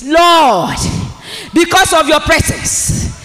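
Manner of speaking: loud, high full-force preaching; shouted prayer. Real, not acted. Preacher leading the standing church in prayer, shouting high. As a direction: lift your voice to the Lord. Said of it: "rise on your feet and say it".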